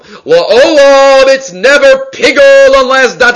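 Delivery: high, loud, drawn-out LA'OLAM; emphatic proclamation. Acted. Teacher acting out the brisa's emphasis, emphatic proclamation. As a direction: proclaim La'olam loudly, holding the pitch high. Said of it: "seems to be emphasizing something".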